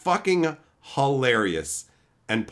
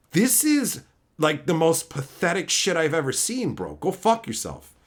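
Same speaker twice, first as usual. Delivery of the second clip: ordinary delivery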